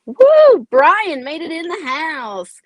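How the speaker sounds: high, loud whoop into a slow announcement; whooping, drawn-out welcome; excited cheer. Real, not acted. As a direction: hail a friend's arrival, stretching the words. Cheering a viewer's arrival.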